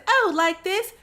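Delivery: high cartoon British question; Susie Sheep, British accent. Friend about to show Peppa a whistle, high mock-British accent. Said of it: "And so Susie says".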